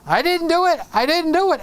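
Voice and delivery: hammed-up whiny denial; mock innocent protest. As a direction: plead innocence like a caught kid.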